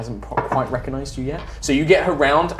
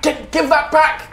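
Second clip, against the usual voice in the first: demanding woman; 3 dB louder than usual. Acted. GM voices struggle over necklace.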